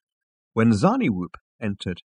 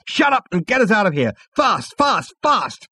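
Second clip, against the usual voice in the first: high, urgent command; impatient order; 10 semitones higher, 3 dB louder than usual. A narrator's reading. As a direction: cut in and hurry them along. Zaphod cuts off the computer, urgent.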